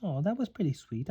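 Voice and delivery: ordinary delivery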